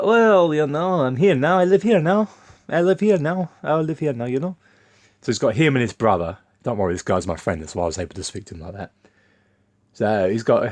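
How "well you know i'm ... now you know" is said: much higher, louder imitation of a Romanian accent, repeating firmly. Romanian coworker insisting he lives here now, in a Romanian accent. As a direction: mimic the Romanian guy's accent, standing his ground. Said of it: "I'll do it in his accent".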